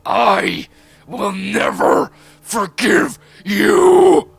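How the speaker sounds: full-force, drawn-out furious yell. A narrator's reading. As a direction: roar it out high and slow. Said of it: "and then his voice".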